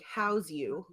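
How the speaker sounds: normal talking voice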